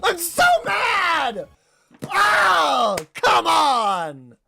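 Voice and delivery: high, loud exasperated shouting, slowing toward the end; real frustration rising into a pained cry; frustrated yelling. Real, not acted. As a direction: yell it out at losing the match. Just lost the battle.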